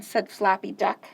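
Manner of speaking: usual speaking voice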